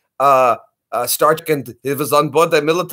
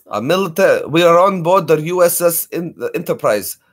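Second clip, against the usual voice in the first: put-on lightly done Russian accent, Star Trek officer; playful imitation of a Russian-accented starship officer; Chekhov's Russian accent. Recalling Star Trek on air, hammed-up Russian-accented Chekhov. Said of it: "Chekhov and his accent".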